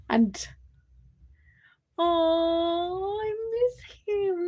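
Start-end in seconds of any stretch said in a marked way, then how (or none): 2.0-4.5 wistful longing. Real, not acted. Missing the old dog Scruffy.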